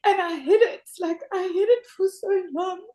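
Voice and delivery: crying out thanks, voice breaking; real crying. Real, not acted. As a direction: thank them through real sobs. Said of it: "because you're crying".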